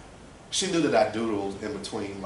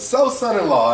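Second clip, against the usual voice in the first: loud, high imitation of a mother; booming mom announcement; 12 semitones higher, 13 dB louder than usual. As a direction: announce it big and high as a punchline. Mother greets boyfriend, punchline.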